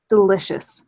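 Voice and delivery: ordinary talk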